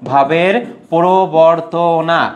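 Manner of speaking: slow, stretched answer with the last vowel held; drawn-out answer. Shown as a demonstration. Teacher answering own quiz question, slow and drawn out. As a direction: draw out the key term so it sticks. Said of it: "কি থাকে বলো তো?".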